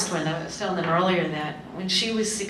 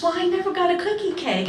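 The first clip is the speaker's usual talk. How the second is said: high sulky complaint; imitated grown daughter whining about her cake; whiny daughter; 12 semitones higher, 3 dB louder than usual. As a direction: pout it like a sulking child.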